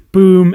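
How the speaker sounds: loud mock boom. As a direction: slam it like a move landing. Imitating a finishing move impact.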